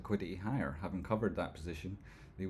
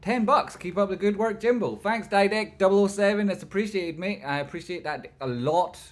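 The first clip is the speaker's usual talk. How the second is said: high, loud shout of gratitude, easing slightly; loud, high hype-man thanks; excited thanks; 10 semitones higher, 9 dB louder than usual. Real, not acted. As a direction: shout out a donor with gusto. Thanks a viewer for donation.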